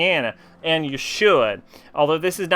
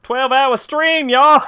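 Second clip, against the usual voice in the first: higher, slow, louder hype yell; hype shout; 9 semitones higher, 7 dB louder, slower than usual. Real, not acted. Streamer whooping to chat about a twelve hour stream, excited yell. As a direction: stretch it out and whoop the y'all.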